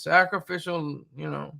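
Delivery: normal talking voice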